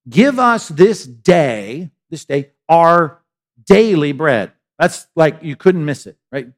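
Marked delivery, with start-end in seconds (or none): 0.1-4.5 slow and louder, leaning on each word; slow emphatic recital. Shown as a demonstration. Preacher quoting Jesus's prayer to the congregation, slow and emphatic. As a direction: give us this day, this day, drawn out with weight.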